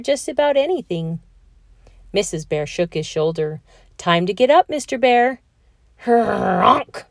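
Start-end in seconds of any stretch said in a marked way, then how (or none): 4.0-5.4 louder, higher call to wake him; raised wake-up call. A narrator's reading. Waking a sleeping bear. 6.0-7.1 a big honking snore; snoring honk; loud snore. A narrator's reading. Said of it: "snored Mister Bear".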